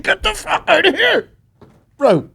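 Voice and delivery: laughing shout at the host's urinate-freely tip; laughing yell. Retold or imitated. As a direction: yell it while cracking up. Same outburst, laughing.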